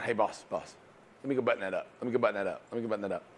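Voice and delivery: imitating a soft, pleading murmur to the boss; hushed and eager, begging to close; quiet mock plea. Salesman murmuring to his boss, quietly itching to close. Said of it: "Ken's going".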